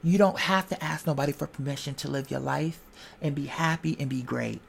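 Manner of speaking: usual speaking voice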